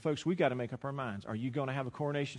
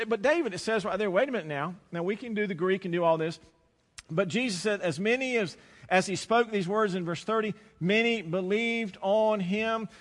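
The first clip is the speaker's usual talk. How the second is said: full-force preaching; 6 semitones higher, 5 dB louder than usual. Acted. Preacher pounds the scripture home to the congregation, loud and high. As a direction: drive the text home from the pulpit.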